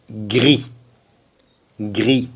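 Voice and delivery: "gris" ending on a clean i, no s; two isolated "gris", s left silent; silent final S. Shown as a demonstration. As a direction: say "gris" twice as "gree" with French r. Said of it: "Mais on ne prononce pas".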